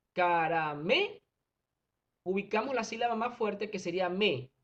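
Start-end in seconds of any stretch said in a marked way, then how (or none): 0.1-1.2 "Caramé" drawn out slowly, rising on a strong final "mé"; stress on ME. Shown as a demonstration. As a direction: stretch "Caramé" and lean hard on "mé". ("Caramé" lento, con la sílaba "mé" marcada)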